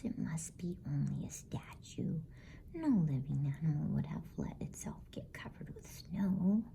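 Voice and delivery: ordinary delivery, nothing marked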